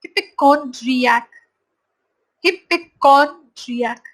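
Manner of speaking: hypochondriac drawn out very slowly; slow pronunciation drill. Shown as a demonstration. Teacher drills the last word, very slow. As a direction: spell out hypochondriac slowly for the class. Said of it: "tenth word हो गया".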